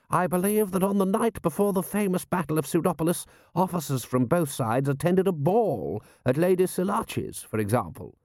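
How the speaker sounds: high-pitched character voice of a lord; pompous lord. Acted. Lord telling a prince about officers' customs, pompous.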